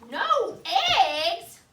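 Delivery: high, loud, laughing outraged question; shocked cry. Acted. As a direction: cry out in disbelief. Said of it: "cried Farmer Brown".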